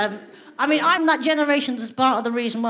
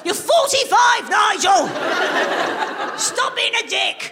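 exasperated mum yelling, high and slow; mock-furious parent yell; scolding parent; 10 semitones higher, slower than usual. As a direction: tell off your forty-five-year-old son. Parent scolds grown son.

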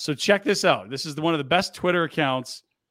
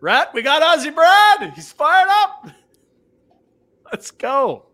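loud, high, excited call-out; big fired-up shout; hyped announcement; 12 semitones higher, 10 dB louder, much slower than usual. Real, not acted. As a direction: hype it up like a stadium announcer. Hyping the Ozzie Brad clip.

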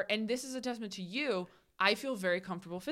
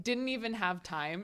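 usual speaking voice

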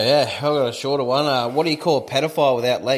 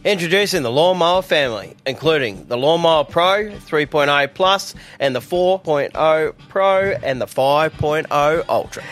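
upbeat sponsor read, lightly sold. Acted. Host reading the Manscaped ad to listeners, upbeat ad read. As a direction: sell the trimmer like a radio ad.

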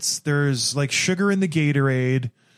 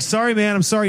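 higher-pitched, pleading mock apology; retold harried apology; 7 semitones higher than usual. Swimmer apologizes to yelling official, mock harried. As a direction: act out apologizing to someone yelling.

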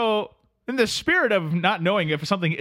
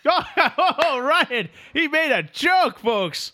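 laughing mock praise; 10 semitones higher, much slower than usual.

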